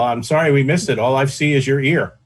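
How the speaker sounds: usual speaking voice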